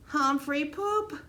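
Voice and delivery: put-on slow, high, delighted toddler yell. Derek cleaning the cage, high delighted toddler yell. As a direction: shout it out like a giggling little boy. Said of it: "he yelled".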